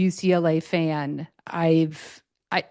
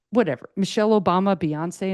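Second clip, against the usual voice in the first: ordinary delivery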